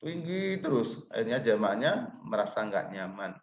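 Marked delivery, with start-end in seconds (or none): 0.0-1.1 pitched way up and drawn out; too high. Shown as a demonstration. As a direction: hold a slow, high, unvarying note. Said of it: "terlalu tinggi".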